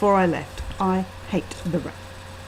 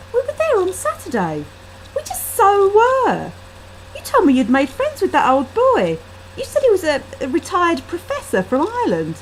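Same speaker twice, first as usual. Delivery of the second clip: insistent friend; 9 semitones higher, 3 dB louder than usual. A narrator's reading.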